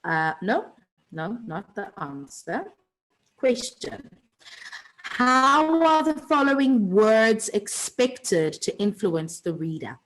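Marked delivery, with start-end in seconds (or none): 3.4-10.0 reading the question out slowly and loudly, every word high and clear. Shown as a demonstration. Teacher reading a worksheet question to her class, slow and loud. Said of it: "teacher reading out the question".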